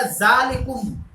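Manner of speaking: "जालिकुम" said slowly with a clear final kum; slow pronunciation demo. Shown as a demonstration. Teacher slowly sounds out the Arabic word for students. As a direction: stretch out "जालिकुम", landing on kum. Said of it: "कुम मतलब जालिका नहीं करना है".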